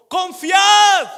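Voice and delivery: high, loud commanding shout. Acted. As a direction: call out over the storm. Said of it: "Jesús les gritó". (grito fuerte y agudo)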